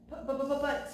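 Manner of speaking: splutter, lost for words; flustered secretary stumbling on but, but; sputtering. A narrator's reading. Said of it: "sputtered Mister Secretary".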